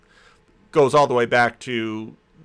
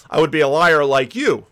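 brutal retort; 3 semitones higher, 7 dB louder than usual. Acted. Rereads the line he called brutal.

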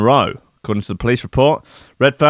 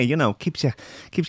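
normal talking voice

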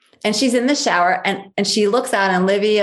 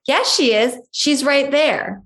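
put-on higher, slow little-child insistence; insistent toddler; 6 semitones higher, slower than usual.

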